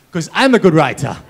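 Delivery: hammed-up Mum crowing over her ten out of ten; boastful Mum. Mum's boastful punchline.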